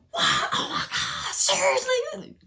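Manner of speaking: telling on yourself by overacting; extra fake surprise. Overdoing surprise at a friend's secret, swinging wildly. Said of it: "you do extra".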